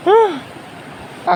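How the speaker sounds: sudden high yelp of shock; startled yelp. Real, not acted. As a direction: jump and yelp in fright. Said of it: "kaget aku".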